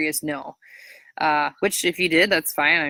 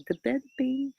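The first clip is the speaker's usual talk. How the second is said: tongue slip; 9 semitones higher, 4 dB quieter than usual. Real, not acted.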